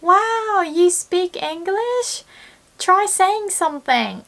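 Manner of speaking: high, excited mock plea; excited friend. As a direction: gush like a thrilled friend. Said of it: "usually people would say".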